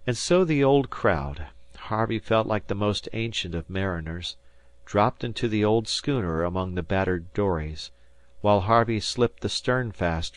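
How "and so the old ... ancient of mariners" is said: usual speaking voice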